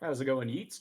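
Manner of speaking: ordinary talk, nothing marked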